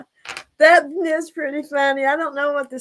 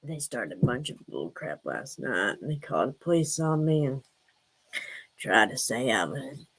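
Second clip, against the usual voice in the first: deep pitched-down character voice; Jody Sue voice; 11 semitones lower, 4 dB quieter than usual. Acted. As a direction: lower and softer, a filtered persona. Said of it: "I'm Jody Sue".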